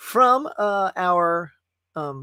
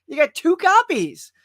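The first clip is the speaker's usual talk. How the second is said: louder, higher, mock exasperation at the clerk; a loud, high, incredulous well duh; exasperated retort; 4 semitones higher, 9 dB louder, slower than usual. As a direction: throw the obvious back at him, loud and high. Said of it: "I'm like".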